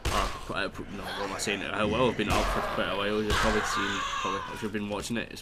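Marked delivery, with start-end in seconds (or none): none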